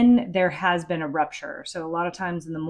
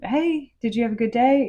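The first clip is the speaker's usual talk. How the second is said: put-on higher, upbeat parent's question to a teen; bright parent greeting; 5 semitones higher, 4 dB louder than usual. Mom greeting her teen after school, pitched up and cheerful. Cheerily asking kids about their day.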